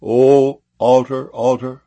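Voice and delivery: loud, slow, drawn-out invocation; crying out. A narrator's reading. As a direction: cry it out solemnly, stretching the first word. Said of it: "he cried against the altar".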